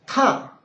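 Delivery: TA falling sharply from very high; fourth tone. Shown as a demonstration. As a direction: throw TA down from the top. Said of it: "fourth ta".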